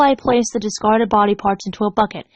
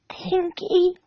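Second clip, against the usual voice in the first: higher, much softer choked plea; 9 semitones higher, 5 dB quieter than usual. Acted. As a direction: squeeze it out through tears. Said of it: "she choked out".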